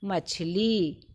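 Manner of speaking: ordinary delivery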